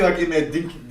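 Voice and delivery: usual speaking voice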